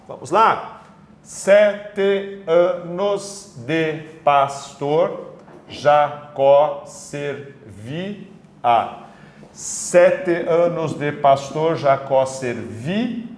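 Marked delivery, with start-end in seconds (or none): 1.2-8.3 "Sete anos de pastor Jacó servia" very slowly, a gap after each syllable; teacher dividing "Sete anos de pastor Jacó servia" syllable by syllable. Shown as a demonstration. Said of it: "separação silábica normal". 9.5-13.2 "Sete anos de pastor Jacó servia" slowly, each syllable distinct but linked, held on "vi"; measured syllabic reading of "Sete anos de pastor Jacó servia"; syllables marked out. Shown as a demonstration. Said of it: "a última sílaba é o servir".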